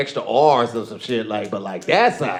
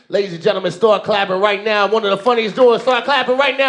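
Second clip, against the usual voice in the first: rising emcee call, last line high and stretched; hype announcer introduction; 9 semitones higher than usual. Real, not acted. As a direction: whip up applause, building to the name. Host introduces the next comic.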